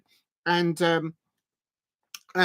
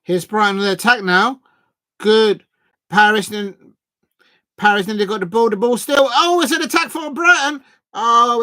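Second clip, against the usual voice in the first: excited commentary; 3 semitones higher, 6 dB louder than usual. Real, not acted. Brighton attack building up.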